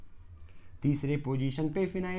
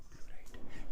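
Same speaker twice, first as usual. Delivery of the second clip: whisper; 8 dB quieter, slower than usual. Shown as a demonstration.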